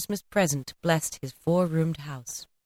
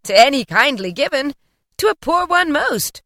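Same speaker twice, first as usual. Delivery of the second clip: high and loud, generous ghost; big-hearted spirit declaring; hearty spirit; 7 semitones higher, 5 dB louder than usual. Acted. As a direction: proclaim it like a jolly giant. Spirit answers Scrooge.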